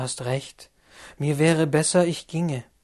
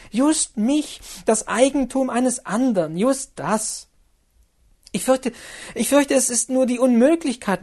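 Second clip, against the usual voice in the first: put-on higher, louder, urgent young woman's plea; Lotte pleading; 8 semitones higher, 5 dB louder than usual. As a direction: beg him to calm down, rising urgency.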